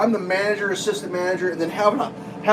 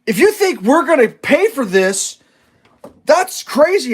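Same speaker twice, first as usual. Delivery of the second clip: mock indignant clerk; 5 semitones higher, 4 dB louder, slower than usual. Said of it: "she goes".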